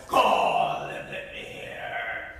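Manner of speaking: breathy slow demand; monster voice. Acted. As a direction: dare her.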